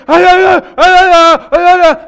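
high, loud mock screams of fright; imitating a terrified scream. Big E running out of the bathroom, mock terrified screaming. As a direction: shriek it like you just saw a ghost at the window.

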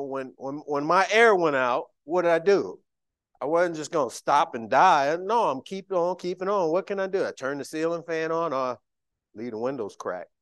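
loud, high preaching; fired-up preaching. Real, not acted. Preacher rousing the church about his broken air, fired-up. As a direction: preach it out to the congregation.

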